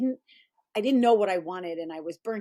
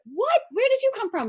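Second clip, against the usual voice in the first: much higher mock astonishment; imitating amazed friends; 13 semitones higher, 4 dB louder than usual. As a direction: play the friends gasping in disbelief. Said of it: "all my friends were like".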